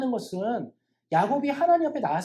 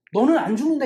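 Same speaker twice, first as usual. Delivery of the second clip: louder, higher declaration of reassurance; emphatic assurance; 4 semitones higher, 8 dB louder than usual. Acted. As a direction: proclaim it loudly as a promise. Voicing the assurance Jacob longs for. (힘주어 크게 확언하는 말투)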